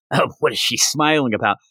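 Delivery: ordinary delivery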